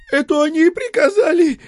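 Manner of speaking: a surprisingly thin, piping voice; thin little voice. A narrator's reading. Coachman answering in an unexpectedly thin voice. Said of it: "неожиданно тонким голоском".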